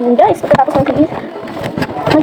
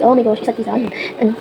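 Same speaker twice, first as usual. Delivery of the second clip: usual speaking voice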